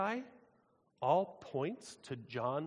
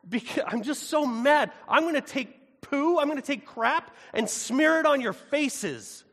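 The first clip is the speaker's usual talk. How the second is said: much higher and louder, slow, outraged divine voice; angry God; 10 semitones higher, 10 dB louder, slower than usual. Acted. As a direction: thunder it out as a wounded, furious God. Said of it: "He says".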